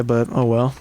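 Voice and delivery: ordinary talk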